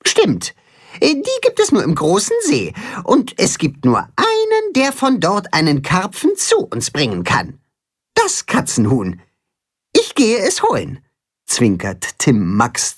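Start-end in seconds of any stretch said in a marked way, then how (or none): 0.1-11.0 much higher, eager hen; bright chicken voice. Acted. Timme promises to fetch a carp, high and eager. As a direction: announce the plan with zest.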